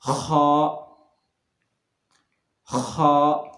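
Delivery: खा scraped out from the upper throat; scraped kha. Shown as a demonstration. Teacher demonstrating the letter, scraped from throat. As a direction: clear the throat into खा. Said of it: "देख लें आप इसको".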